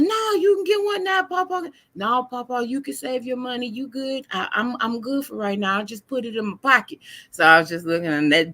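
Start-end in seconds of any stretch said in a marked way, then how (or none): 0.0-1.7 high, pleading voice; high coaxing. Retold or imitated. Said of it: "And he said". 1.9-7.0 put-on high child voice refusing; a lightly voiced kind little boy; polite child. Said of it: "And he said".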